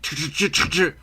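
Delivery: mock car engine failing to start, sputtering; a quick stuttering engine crank; imitated sputtering engine. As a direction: make the stalling car sputter. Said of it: "Classic horror movie trope, like".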